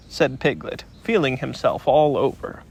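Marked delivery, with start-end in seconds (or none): none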